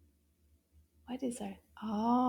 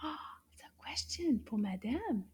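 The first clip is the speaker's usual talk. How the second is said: put-on hushed, secretive question to the kids; playful whispered quiz question; 6 dB quieter than usual.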